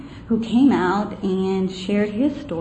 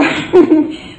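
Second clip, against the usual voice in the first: loud, breathy burst of relieved laughter; 8 semitones higher, 8 dB louder than usual. Real, not acted. Recalling the council vote to the audience, a breathy burst of laughter. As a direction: laugh out in disbelief at the win.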